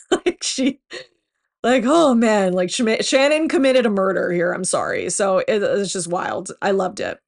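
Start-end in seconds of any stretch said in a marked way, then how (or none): 0.1-4.5 gleeful excitement. Real, not acted. Gleeful over Shannon's clapback.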